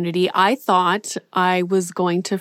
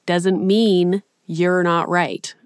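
slow, lightly drawn-out Texan imitation; a light imitation of his slow Texas delivery; slowed-down mock Texas drawl; Matthew McConaughey accent; slower than usual. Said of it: "I don't know why I said that in his accent".

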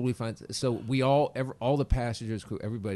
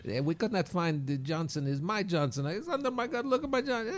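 higher broken-English captain impression; doing the captain; 6 semitones higher than usual. Comic impersonates the ship's captain to the audience, mock Italian accent. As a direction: mimic the Italian captain on stage. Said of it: "I just do the captain".

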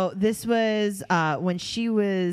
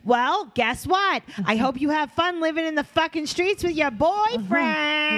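mock angry mother; 9 semitones higher, slower than usual. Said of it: "most iconic Teen Mom Online".

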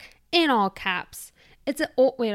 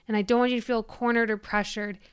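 ordinary talk

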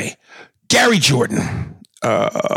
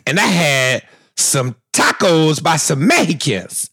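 rising higher and higher, Magic Johnson voice; Magic Johnson impression; 4 semitones higher than usual. Host does Magic Johnson, climbing higher. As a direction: imitate Magic Johnson's cheerful pitch climbing.

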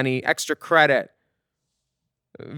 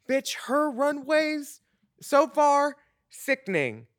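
dramatic praise; 9 semitones higher, 5 dB quieter, slower than usual. Real, not acted.

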